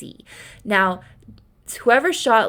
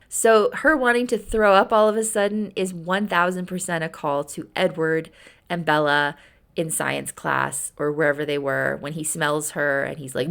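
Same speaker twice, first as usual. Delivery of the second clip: ordinary delivery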